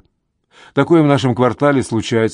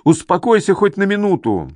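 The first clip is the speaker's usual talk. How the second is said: high, urgent plea to calm down; high-pitched narrated yell; exasperated yell; 8 semitones higher than usual. A narrator's reading. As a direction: call out in a high, agitated voice. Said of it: "орёт Стивен".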